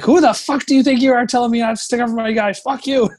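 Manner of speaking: fast, high, loud furious outburst; furious scream. Retold or imitated. Goalie screaming back at his coach, furious and fast. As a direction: blow up at the coach, rattling it off. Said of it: "I was screaming".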